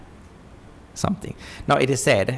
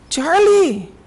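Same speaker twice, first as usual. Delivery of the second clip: officer calling out; 6 dB louder than usual. Retold or imitated. Officer calls the boy.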